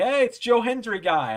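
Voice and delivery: high, loud greeting from fellow fans; excited hail. Retold or imitated. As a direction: call out as if spotting a familiar face. Friends hail him excitedly in story.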